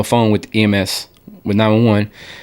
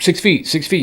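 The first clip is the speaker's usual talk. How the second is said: high, sing-song calling out; mock safety call; 8 semitones higher than usual. Bored safety marshal repeating it all day, high call. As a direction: bark the rule at passing workers. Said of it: "you just".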